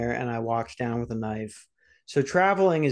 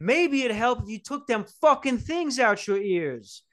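higher, mocking janitor voice; snarky worker; 10 semitones higher than usual. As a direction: sneer it like a fed-up janitor. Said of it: "And he goes".

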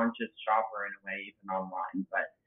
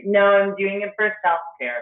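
higher, drawn-out mock justification; mock insistence; 8 semitones higher, 7 dB louder than usual. Cookie eater justifying herself as self-care, mock-insistent.

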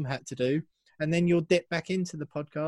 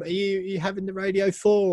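normal talking voice

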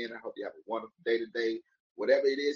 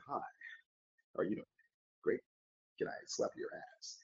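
hushed polite ask; 9 dB quieter than usual. Shown as a demonstration. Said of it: "I would suggest walking up to somebody".

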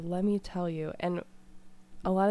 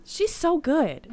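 gushing; 8 semitones higher, 5 dB louder, much slower than usual. Real, not acted. Gushing about [name].

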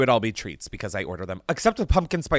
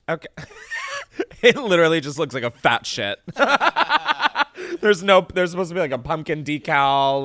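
laughing; 4 semitones higher, 5 dB louder than usual. Real, not acted. Laughing at melted candy.

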